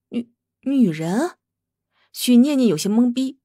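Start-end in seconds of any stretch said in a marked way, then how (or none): 0.1-1.4 slow, stammering disbelief; stunned stammer. Acted. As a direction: stammer it out in shock. Stunned reply to the baby.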